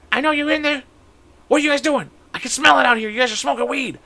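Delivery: high-pitched mom voice calling into the shed; friend's mom calling out. Retold or imitated. Mom outside the shed catching the boys smoking, high calling voice. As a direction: mom shouting through the shed door, high and suspicious. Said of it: "it's his mom".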